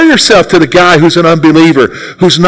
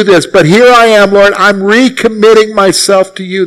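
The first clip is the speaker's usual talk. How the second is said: earnest prayer; 4 semitones higher than usual. Acted. Models a prayer of trust.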